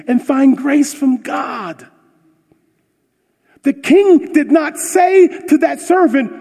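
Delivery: high, loud preaching at full force; fiery preaching. Acted. Pastor preaching to his church, loud and high. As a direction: preach it hard to the congregation.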